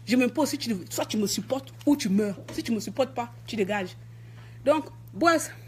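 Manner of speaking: normal talking voice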